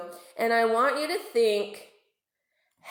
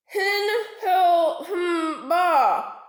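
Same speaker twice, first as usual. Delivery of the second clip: high, very slow gummy threat; toothless warning; 8 semitones higher, much slower than usual. A narrator's reading. Toothless shark warns narwhals he'll return, slow gummy threat. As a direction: threaten through bare gums. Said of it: "he warned".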